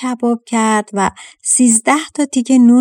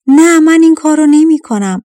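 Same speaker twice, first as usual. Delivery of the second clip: higher and louder, a firm shouted no; shouting refusal; 7 semitones higher, 6 dB louder than usual. A narrator's reading. Old servant shouts his refusal to kill the girl, loud and firm. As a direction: refuse outright at full voice. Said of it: "فریاد زد".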